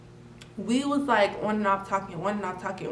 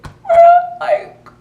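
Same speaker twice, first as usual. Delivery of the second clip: high, loud overjoyed cry; squealing thanks to mom; excited cry; 8 semitones higher, 9 dB louder, slower than usual. Retold or imitated. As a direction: shriek it with grateful relief. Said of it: "I'm like".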